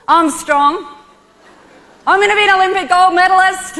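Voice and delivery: hammed-up tough athlete boasting; mock bravado. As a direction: puff yourself up, overconfident. Retelling her bravado to surgeon.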